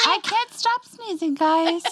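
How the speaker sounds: high, loud mock whine; hammed-up sneezing excuse; mocking imitation. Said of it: "And then you said".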